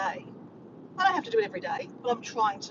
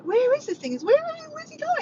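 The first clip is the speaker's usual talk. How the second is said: high, louder, stammered questions; excited confusion; 5 dB louder than usual. Retold or imitated. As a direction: re-enact baffled urgency. Re-enacts excited confused reaction to message.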